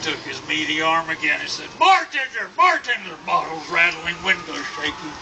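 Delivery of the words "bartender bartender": high, loud holler across a bar; demanding shout. Acted. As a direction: bellow for service like a huge cowboy. Huge man demanding the bartender.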